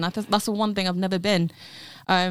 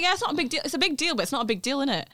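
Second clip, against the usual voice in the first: higher, quick offhand retelling; casual downplaying; 6 semitones higher, much faster than usual. Brother brushing off his big news, casual. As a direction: imitate someone brushing it off.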